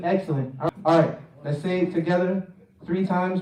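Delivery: ordinary delivery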